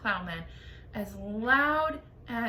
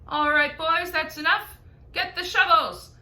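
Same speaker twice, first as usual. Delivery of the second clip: loud, higher bossy shout to his crew; cloud man shouting; 7 semitones higher, 9 dB louder than usual. A narrator's reading. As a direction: call the men to work across the cloud. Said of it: "heard him shouting".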